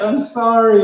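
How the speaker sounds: loud, high-pitched tearful apology; mock crying. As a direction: imitate a softie blubbering an apology. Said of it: "and he's crying".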